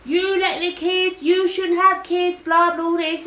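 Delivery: high, loud, drawn-out scolding; imitated shouting. Stranger shouting at her about kids, imitated scolding. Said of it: "she was shouting and shouting and shouting".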